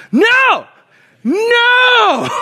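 high-pitched, loud shriek of NO; unmanly panicked scream. Retold or imitated. Husband finding a giant snake at home, high panicked scream. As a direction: squeal it out in hammed-up terror. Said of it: "this was my response".

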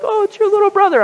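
high-pitched cooing imitation; doting parents. Parents introducing the dog as his brother, mock doting. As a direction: gush over the pet in a put-on high voice.

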